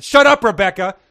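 loud, high angry yell; read-aloud shout at a teasing girl. A narrator's reading. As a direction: bark it at her. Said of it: "Thomas shouted".

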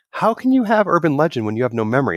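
incredulous retort. Retold or imitated. Speaker retelling own reaction to Luke, incredulous. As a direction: act out your own disbelief at the urban legend. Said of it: "and I was like".

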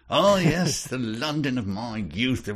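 high, hammed-up posh British accent; mock British accent. Joking how Carlisle's old British accent slips out, mock posh British accent. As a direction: lift it into a fake old-London posh accent. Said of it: "suppress his, uh".

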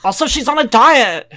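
story read in a put-on British accent. Reader tells of the poisoning worry, British accent. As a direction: play up a British accent, lifting at the end. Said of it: "It's my British accent".

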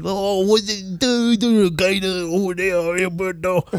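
garbled, drawn-out mock Creole drawl; mock unintelligible accent. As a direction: slur it into near-nonsense Creole parody. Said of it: "could have been a Creole chef".

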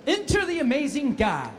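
high, theatrical anime hero entrance; showy character catchphrase, held out at the end; Guy. Shown as a demonstration. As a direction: announce yourself grandly, pitched well up. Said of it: "from Guy".

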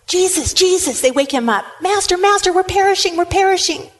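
high, urgent calling out for help; panicked cry. Acted. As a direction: call for the Master in terror of the storm. Disciples cry out in storm.

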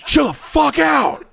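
high, loud, slow retort; hammed-up high, drawn-out shout; exasperated yell. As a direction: yell it back in mock exasperation. Retold yelling back at girlfriend.

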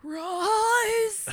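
loud high battle cry; booming warrior king. Retold or imitated. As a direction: shout it like a war leader rallying. Echoing Darrow's battle cry.

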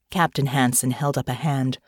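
plain reading voice

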